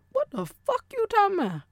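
high-pitched demo of disbelief; high, indignant put-on question; confrontational challenge. Shown as a demonstration. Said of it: "Inflection, tone, it's all in the way".

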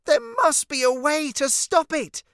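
high, loud cry of alarm. A narrator's reading. Cece desperate to stop the dinosaur, crying out. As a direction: call out urgently in a high child's voice. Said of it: "she cried".

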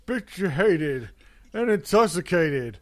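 mocking impression of a cranky old man singer; Grandpa Simpson. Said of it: "fucking Grandpa Simpson going".

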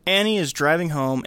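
ordinary talk

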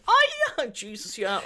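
very high fake agreeing; mock agreeing squeal. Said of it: "So I was like".

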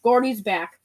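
usual speaking voice

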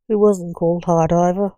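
plain reading voice

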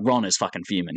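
ordinary talk, nothing marked

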